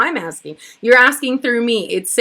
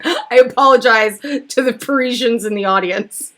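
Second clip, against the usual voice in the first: ordinary talk, nothing marked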